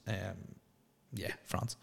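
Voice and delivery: a secret tip, whispered; whispered aside. Real, not acted. Podcaster quietly settling his Euros bet, in a whisper. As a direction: whisper it softly.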